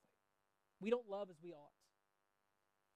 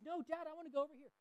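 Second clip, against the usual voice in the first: put-on higher, quick, insistent little kid; son insisting; 8 semitones higher, faster than usual. Son insisting to dad on another road, quick higher childlike voice. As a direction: whine to go your own way, childlike. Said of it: "He says".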